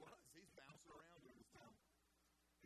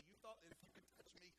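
ordinary talk, nothing marked

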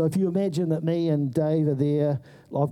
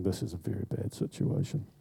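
hushed aside; 7 semitones lower, 8 dB quieter than usual. Retold or imitated. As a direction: lower and softer, under the breath. Hiding in the bush.

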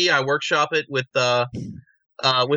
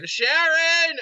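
a much higher, whining call of the name; 12 semitones higher than usual. Retold or imitated. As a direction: wail the name like a dazed rock star. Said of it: "it's like [name]".

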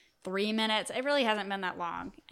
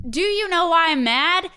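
mocking the customer; 8 semitones higher, 9 dB louder than usual. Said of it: "mocking the rude customer".

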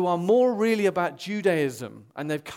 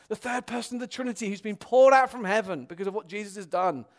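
usual speaking voice

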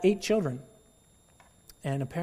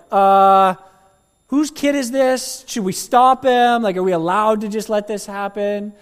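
high, loud, slow fretting; worried onlookers; 6 semitones higher, 12 dB louder than usual. Acted. Crowd frets about the boy, high anxious. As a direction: fuss like nervous bystanders.

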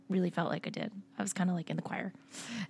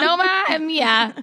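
exaggerated Boston accent, drawn out; 9 semitones higher, 8 dB louder, slower than usual. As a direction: stretch it in a thick Boston accent. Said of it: "I'm not gonna do my Boston accent anymore".

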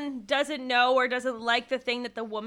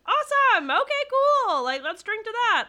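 much higher, cheery and quick; mock upbeat girlfriend; 10 semitones higher, 4 dB louder than usual. Girlfriend cheering the win with him, high and cheery. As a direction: toast it breezily. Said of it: "she'd be like".

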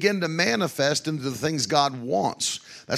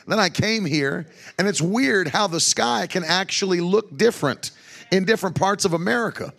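ordinary talk

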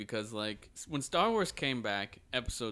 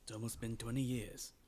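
soft, lightly voiced Jedi master; Obi-Wan Kenobi; 8 dB quieter than usual. Host trying his best Jedi impression, softly voiced. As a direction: attempt a quiet British Jedi voice. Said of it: "my best Obi-Wan Kenobi voice".

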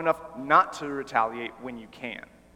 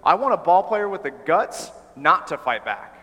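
firm and louder, pressing each point; emphatic; 3 semitones higher, 7 dB louder than usual. Acted. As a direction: plant your feet and insist. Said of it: "answers emphatically".

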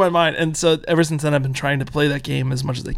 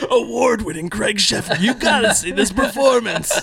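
cheesy promo voice; 5 semitones higher than usual. Retold or imitated. Mocking Netflix blurbs.

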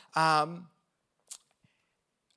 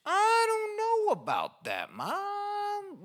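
much higher, slow, whining protest; mock whimper of a doubtful child; whiny teen; much slower than usual. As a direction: whine back at mom like a cornered kid. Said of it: "And you're like".

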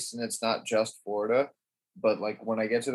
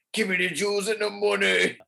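high, put-on movie robber demand; 7 semitones higher than usual. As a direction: growl it like a heist robber. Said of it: "Just watch him rob and be like".

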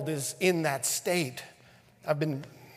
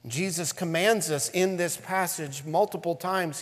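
ordinary talk

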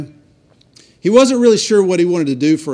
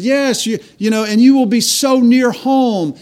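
mother's voice, higher and louder with delight; excited mother; 6 semitones higher, 3 dB louder than usual. Retold or imitated. Mother thrilled her son will stay near home, excited. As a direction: bubble over with joy at the news.

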